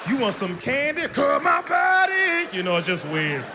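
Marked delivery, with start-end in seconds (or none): 0.1-2.5 creepy candy stranger. Pretend. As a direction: coax like a creepy stranger, drawing it out. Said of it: "you can't just go up to a kid".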